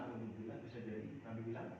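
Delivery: usual speaking voice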